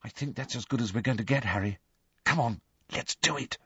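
soft, hushed words; quiet. A narrator's reading. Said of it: "Hermione said quietly".

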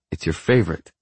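ordinary delivery

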